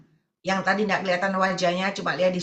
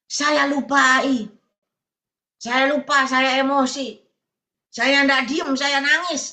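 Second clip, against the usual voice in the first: higher, louder imitation of an upset confession; retold emotional protest; 4 semitones higher, 4 dB louder, slower than usual. Church member confessing an outburst to the preacher, mock-emotional protest. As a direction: act out someone owning up, worked up and loud.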